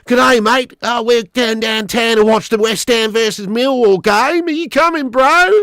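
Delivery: high, loud, exaggerated Aussie twang; mock Australian accent. Streamer mocking an actor's awful Cockney, exaggerated Australian accent. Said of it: "he sounds like he's from fucking Australia".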